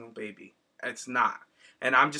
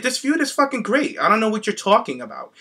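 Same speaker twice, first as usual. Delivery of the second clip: excited; 7 semitones higher, 4 dB louder than usual. Retold or imitated. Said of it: "I was like".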